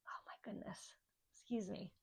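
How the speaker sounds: breathless whispered aside; soft unvoiced oh my goodness. Real, not acted. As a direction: whisper it to yourself, overcome.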